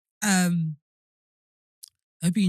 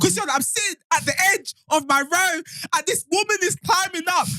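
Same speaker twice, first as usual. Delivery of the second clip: excited; 10 semitones higher, 4 dB louder than usual. Retold or imitated. Panicked retelling of demon scene.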